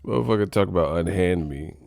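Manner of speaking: normal talking voice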